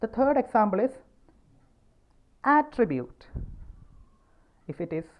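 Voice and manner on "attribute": "attribute" with AT high and punched, then falling; careful noun "attribute", AT-tribute; stress on first syllable. Shown as a demonstration. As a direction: hit the opening AT of "attribute". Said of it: "first syllable".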